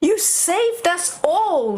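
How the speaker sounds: a hint of a grateful king, drawn out; lightly voiced king thanking. King thanking Charlie for saving everyone, lightly voiced. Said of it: "said the king".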